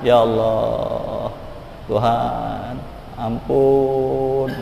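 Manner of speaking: soft, drawn-out cry to God; hushed penitent pleading, lightly acted; mock tearful plea. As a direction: act out begging forgiveness, slowly and quietly.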